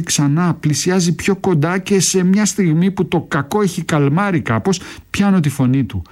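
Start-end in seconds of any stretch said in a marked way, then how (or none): none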